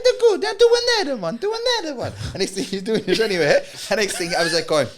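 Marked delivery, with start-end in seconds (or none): 0.0-2.1 squeaky high mock nonna scolding; Italian nonna. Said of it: "And they're like".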